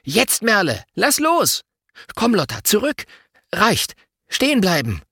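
high, urgent string of orders; excited team captain's commands; commanding. A narrator's reading. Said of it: "Luis gibt das Kommando".

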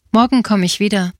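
plain reading voice

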